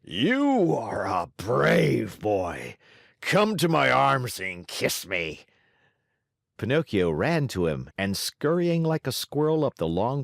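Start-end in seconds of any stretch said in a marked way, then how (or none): 0.0-5.4 warm giant voice. Acted. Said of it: "opened wide his arms".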